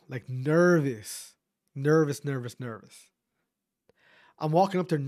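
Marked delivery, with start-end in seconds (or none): 0.1-3.1 nervous drawn out slow, each repeat hammered; stress on nervous. Retold or imitated. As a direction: stretch out every nervous slowly, hitting it hard. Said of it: "I get nervous, bro".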